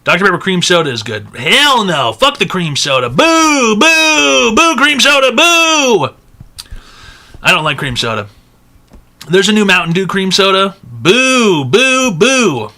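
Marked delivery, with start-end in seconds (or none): 3.1-6.1 loud, high jeering boos; booing. Real, not acted. Streamer booing cream soda to his chat, loud high jeers. 10.8-12.7 booing. Real, not acted. Booing Mountain Dew cream soda.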